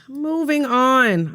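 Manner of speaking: slow, sing-song drawn-out moving on; slow sing-song sign-off. Real, not acted. Drawn-out transition to next topic.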